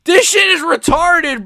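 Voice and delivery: furious high yelling, slow and drawn out; outraged shouting. Real, not acted. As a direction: scream at the ref's blunder. Ref stopped fight then restarted.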